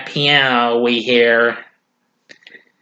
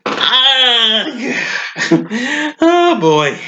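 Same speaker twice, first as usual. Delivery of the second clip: laughing; 6 semitones higher, much slower than usual. Real, not acted. Laughing at the scene.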